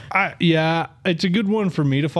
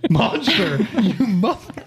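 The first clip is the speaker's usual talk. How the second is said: laughing higher-pitched outburst; 8 semitones higher, slower than usual. Real, not acted. Host cursing own failing voice, laughing. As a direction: say it through a laugh.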